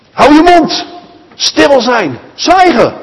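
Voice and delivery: high, sharp scolding commands; angry crowd hushing. Acted. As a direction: shut the beggar up. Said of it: "Zo zeggen de mensen om hem". (boze menigte snauwt hoog en fel)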